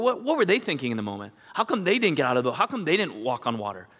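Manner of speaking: ordinary delivery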